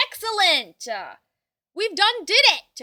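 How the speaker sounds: loud, high victory shout; triumphant cheer. Real, not acted. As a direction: crow about the win. Celebrating setting up the club.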